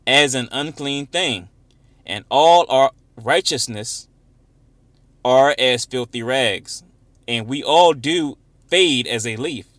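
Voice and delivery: slow, loud, emphatic scripture reading. Acted. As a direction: declaim the verse slowly, easing off at the end. Reads scripture aloud.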